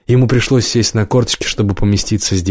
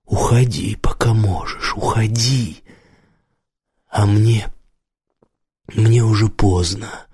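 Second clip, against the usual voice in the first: hoarse, half-whispered urging with long pauses; hoarse, strained; slower than usual. A narrator's reading. Mamed urges the stranger to leave, hoarse and strained. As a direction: strain out a weary warning. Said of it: "голос Мамеда был хриплый и надсаженный".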